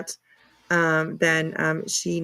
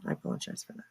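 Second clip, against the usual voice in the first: hushed, breathy whisper; 14 dB quieter, much faster than usual. Shown as a demonstration. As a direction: whisper the apology under your breath. Repeats apology, then whispers it.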